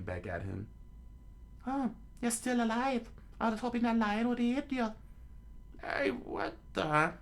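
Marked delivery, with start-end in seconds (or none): 1.7-4.9 put-on scornful girl voice. Nami voice. 5.8-7.1 put-on startled girl voice. Nami voice.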